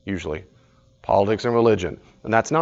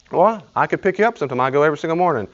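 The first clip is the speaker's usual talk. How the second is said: higher, eager offer; helpful friend; 6 semitones higher, 3 dB louder, faster than usual. Retold or imitated. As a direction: push a cheerful offer to help.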